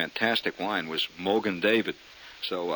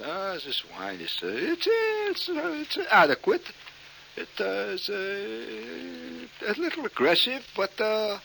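high, drawn-out wine-snob voice; mock wine snob; 9 semitones higher than usual. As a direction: pronounce a pompous tasting verdict haltingly. Pretentious acted wine tasting verdict.

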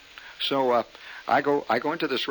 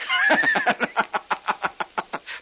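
long breathy burst of laughter; 12 semitones higher, 4 dB louder than usual. Real, not acted. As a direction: laugh out at your own joke. Laughing at his own goulash joke.

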